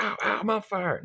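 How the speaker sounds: high, whiny little yelp; exaggerated high "ow, ow" complaint; mock minor-burn yelp. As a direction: make a fuss over a tiny burn. Mocking a minor cigarette burn.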